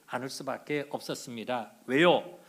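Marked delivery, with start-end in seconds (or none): none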